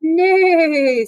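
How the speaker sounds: high, loud neigh; whinny it like a horse. Acted. Horse boarding the tractor, a loud high neigh. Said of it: "said the horse".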